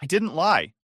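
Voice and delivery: defensively. A narrator's reading. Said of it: "she said defensively".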